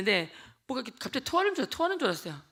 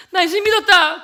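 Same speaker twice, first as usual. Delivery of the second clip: loud, higher joyful shout; excited announcement; 7 semitones higher, 12 dB louder than usual. Retold or imitated. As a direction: burst out with the good news. (친구에게 신나서 외치기)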